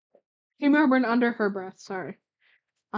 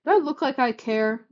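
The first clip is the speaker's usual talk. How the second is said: hissed; 3 semitones higher, 6 dB louder than usual. Acted. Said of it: "Gladion hissed".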